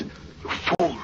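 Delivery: scolding whisper; angry whisper. Acted. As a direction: hiss it at the bungler. Angry at near-discovery, secretly.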